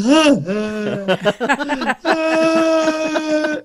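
high comic whimpering wail; Cowardly Lion wail. Retold or imitated. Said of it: "I literally sound like the Cowardly Lion".

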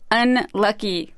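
slow, weighty delivery of unlucky; deadpan punchline. Real, not acted. Host revealing the professor's term, slow deadpan. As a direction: let unlucky land slowly like a punchline.

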